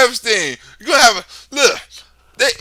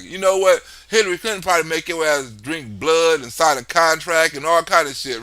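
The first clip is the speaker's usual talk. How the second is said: ordinary talk, nothing marked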